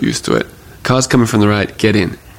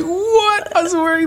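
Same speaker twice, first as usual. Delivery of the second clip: a shocked, much higher squeak; stunned what; slower than usual. Retold or imitated. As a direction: yelp it out in disbelief. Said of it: "And I was like".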